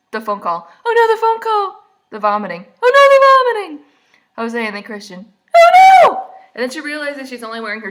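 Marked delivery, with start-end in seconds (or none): 0.9-1.8 loud, very high comic wail; mock horror. Reacting to the remembered phone call, high mock horror. Mock dismay grows louder. 2.8-3.8 very loud, very high comic shriek; shrieked mock horror. Reacting to remembered vomiting, shrieked mock horror. 5.5-6.3 piercingly high, very loud comic scream; mock-horror scream. Climax of the mock dismay.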